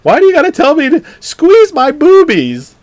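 high, slow, loud whiny complaint; shrill mock outrage at him; exasperated girlfriend voice.

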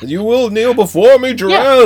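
booming villain voice, laughing; hammy commanding tyrant; booming demon lord. Retold or imitated. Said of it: "Zod is serious comic book".

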